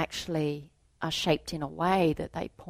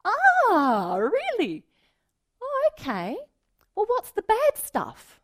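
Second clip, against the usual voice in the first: much higher, louder gentle surprise; surprised delight; 6 dB louder than usual. Retold or imitated. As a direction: coax the child, amazed and curious. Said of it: "I was like".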